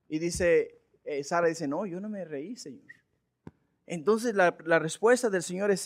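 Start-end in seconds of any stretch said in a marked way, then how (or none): 1.7-2.9 soft, sheepish denial fading out; Sara's denial. Acted. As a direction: deny it under your breath. Sara denies laughing.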